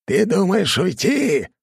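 high, slow, loud yell; god yelling. Acted. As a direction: yell after him menacingly. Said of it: "заорал бог мне вслед". (громкий медленный крик бога)

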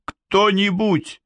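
high, slow frightened call; frightened girl. A narrator's reading. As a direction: call out for help, scared.

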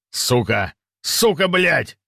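rising furious curse; angry shout. Acted. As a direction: swear in mounting rage. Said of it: "В гневе кричал Ужас".

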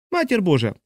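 high, falling exclamation; exclaimed. A narrator's reading. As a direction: cry it out in mild exasperation. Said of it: "вигукнула і мала сестричка".

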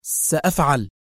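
submissive one-word reply; obedient surrender. A narrator's reading. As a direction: give in meekly. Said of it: "تجيب في طاعة واستسلام". (طاعة واستسلام)

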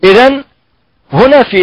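normal talking voice

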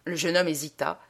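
ordinary delivery

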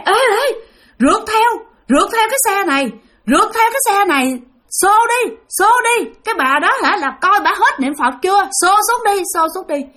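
high, pressing ghost voice; ghost urging the chase. Retold or imitated. Ghost urging the others to chase and push the bus, high. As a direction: urge the gang on, high and eager. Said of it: "ổng lại tiếp tục nghe".

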